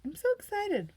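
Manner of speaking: high, bright excited squeal; a high excited squeal quoting herself; gushing excitement. Retold or imitated. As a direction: gush like you can't contain it. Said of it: "except for when I say".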